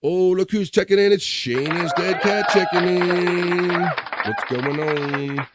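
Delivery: high, drawn-out greeting call; hyped-up welcome. Real, not acted. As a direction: announce a guest's arrival, stretching the last words. Host hails viewer checking in.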